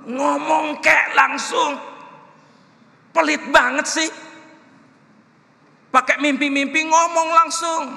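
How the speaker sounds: loud, high, drawn-out shout of protest; exasperated shouted prayer. Retold or imitated. Preacher re-enacting his wrestling with God, exasperated shout. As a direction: yell your complaint up at God. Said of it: "saya bergumul".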